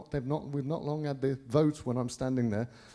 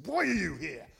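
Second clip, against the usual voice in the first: high, raised menacing demon voice; intimidating demon; 6 semitones higher than usual. Retold or imitated. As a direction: menace the listener as an attacking spirit. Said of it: "it's trying to intimidate me".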